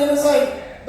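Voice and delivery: usual speaking voice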